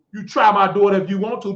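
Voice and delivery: usual speaking voice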